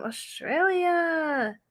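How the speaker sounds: drawn-out, slow mock Australian accent; playful Australian accent for a viewer from Perth. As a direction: stretch it out like a hammy Aussie. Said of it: "I keep doing all these accents".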